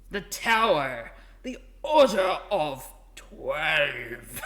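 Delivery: loud, very slow, grandiose title reveal; hammed-up epic announcement; impressive announcer. Shown as a demonstration. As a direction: boom it out, stretching every word. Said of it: "'Cause that doesn't sound very impressive".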